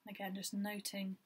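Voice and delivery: even, unhurried meditation guide with long pauses; guided meditation. Acted. Guiding listeners through a body scan, calm meditation voice. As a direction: lead gently in short phrases, leaving space between them.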